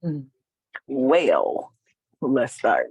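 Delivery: ordinary delivery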